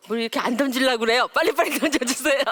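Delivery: loud, fast coaxing; urging. Real, not acted. Lecturer urging the audience to throw, excited. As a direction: hurry the audience along, laughing.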